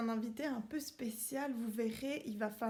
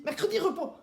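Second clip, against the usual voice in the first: shouted command; 6 semitones higher, 8 dB louder, much faster than usual. Acted. Drill command at end of list.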